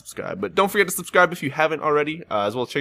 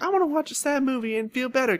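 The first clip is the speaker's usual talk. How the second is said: high, soft whiny voice; mock whiny self; 11 semitones higher, 5 dB quieter than usual. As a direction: play your younger self, pouting. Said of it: "I was like".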